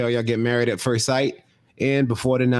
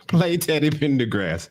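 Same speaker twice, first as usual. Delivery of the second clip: mock smart speaker; 7 semitones higher than usual. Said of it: "They're like".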